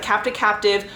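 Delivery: ordinary delivery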